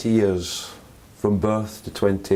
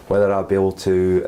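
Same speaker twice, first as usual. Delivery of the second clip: ordinary talk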